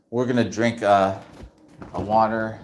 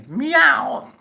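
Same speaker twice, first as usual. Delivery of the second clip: high squeaky kitty meow; playful meow. Pretend. Streamer playfully meows while checking the cage, high squeaky meow. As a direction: toss off a cute cat noise.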